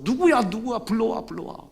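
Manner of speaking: high, loud urgent calling; eager call. Acted. Jesus stopping on the road calling for the blind man, urgent. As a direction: call out, who is it, bring him.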